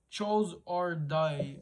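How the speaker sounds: slow, careful English accent on Choose or Die. Shown as a demonstration. Giving the Netflix film title, slow English accent. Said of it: "mon accent anglais".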